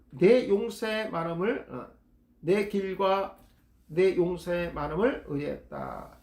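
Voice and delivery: ordinary talk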